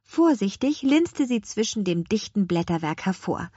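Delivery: ordinary delivery, nothing marked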